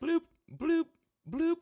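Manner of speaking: high, popping bloop sounds like a phone pinging; phone notification bloops. Retold or imitated. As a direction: mimic the ping of incoming messages.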